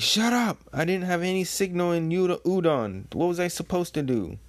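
hammed-up indignant self-defence; defensive retort. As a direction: burst out high, then argue your case. Queen snapping back at Jack.

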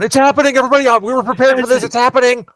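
high, hyped-up mock panic; Michael Scott. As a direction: run around yelling it like a panicked office boss. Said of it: "running around like Michael Scott going".

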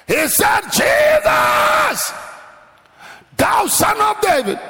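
high, drawn-out shouted proclamation; loud, stretched preacher's shout; full-force preaching. Retold or imitated. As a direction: cry it out to the whole congregation.

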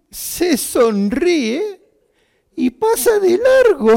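breaking into high, loud laughter; laughing. Real, not acted. Telling listeners the father walked past, laughing loudly. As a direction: laugh it out as the punchline lands.

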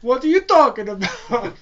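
high, loud mock Italian accent; hammed-up Italian protest; attempted Italian accent. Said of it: "I can't do an Italian accent".

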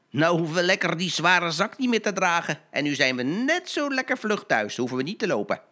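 pleased with their luck; gleeful thieves. Acted. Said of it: "de mannen zeiden".

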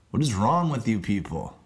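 quick, low-pitched aside. Acted. Host scolding the audience after the sponsor plug, low aside. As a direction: drop down low for a quick jab.

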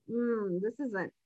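hushed mock Stepford wife; muted Stepford voice. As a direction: trail off like a programmed wife.

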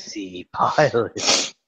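slow sultry pilot voice; sexy pilot voice. Pretend. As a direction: purr it like a smooth pilot. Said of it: "this is".

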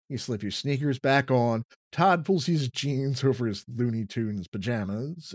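ordinary talk, nothing marked

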